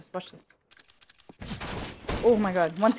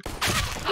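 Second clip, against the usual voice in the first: high, sharp gasp; shocked gasp; 4 dB quieter than usual. Real, not acted. Gamer shocked by losing a fight, sharp gasp.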